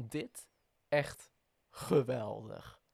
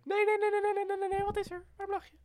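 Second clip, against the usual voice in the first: high-pitched voice; 3 dB louder, much faster than usual. Pretend. Teasing the laughing co-host.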